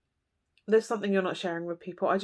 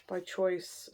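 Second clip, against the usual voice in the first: "By choice" dropped much softer; soft echo; 9 dB quieter than usual. Real, not acted. Reader echoing her point, much softer. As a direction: let "By choice" fall away quietly.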